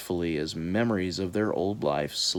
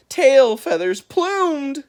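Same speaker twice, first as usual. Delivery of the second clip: plain reading voice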